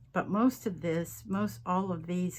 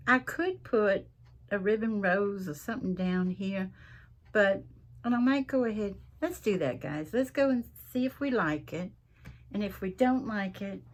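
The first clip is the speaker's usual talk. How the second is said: ordinary delivery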